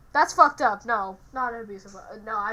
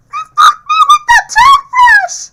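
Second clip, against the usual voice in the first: very high, loud shriek; shrieking yell; 21 dB louder than usual. Acted. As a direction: scream it out laughing. Laughing, acting out a demon.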